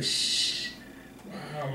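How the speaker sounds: soft, voiceless shushing; a stunned "shh"; retold hush. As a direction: let out a long hush. Acting out stunned hushing reaction.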